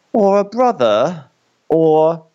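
rising, drawn-out hint; teasing build-up. Retold or imitated. Criston needling Harwin with a slow, rising hint. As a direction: dangle the insinuation.

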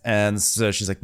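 normal talking voice

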